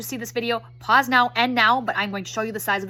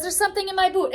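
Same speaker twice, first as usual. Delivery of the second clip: put-on higher-pitched little girl protest; complaining child; 8 semitones higher than usual. Daughter to mom while getting her boots on, complaining. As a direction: whine it like a small kid.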